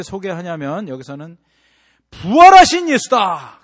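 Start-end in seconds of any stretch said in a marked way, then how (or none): 2.1-3.6 loud, high, slow boast; mock grand proclamation. What Jesus did not say, loud mock proclamation. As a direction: announce it pompously. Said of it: "그렇게 얘기하지 않습니다".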